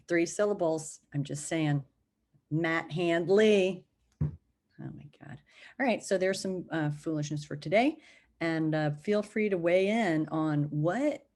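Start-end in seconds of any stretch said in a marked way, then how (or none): 4.8-5.4 breathy whispered exasperation at herself; whispered oh my God. Real, not acted. Voice message to Matt, a whispered embarrassed aside. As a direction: sigh it out under the breath. Embarrassed at her own silliness.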